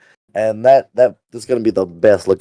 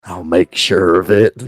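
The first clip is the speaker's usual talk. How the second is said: creepy voice; 4 semitones lower than usual. Shown as a demonstration. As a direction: lure them in like a creep, dragging the words out. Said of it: "put on your creeper voice".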